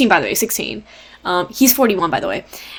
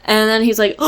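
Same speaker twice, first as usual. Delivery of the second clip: usual speaking voice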